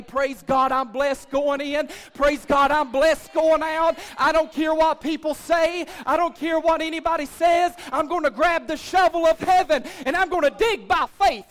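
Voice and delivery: loud, punched declarations of faith; shouted praise. Acted. Pastor voicing believers grabbing heaven's shovel, shouted praise. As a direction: shout it out like a rejoicing congregation. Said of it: "start shouting and say".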